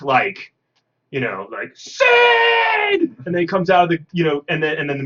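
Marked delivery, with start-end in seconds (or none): 1.8-3.0 high, loud mock yell of a name; imitating a desperate shout; calling out. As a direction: belt the name out across a field like a frantic parent. Character calling out in film.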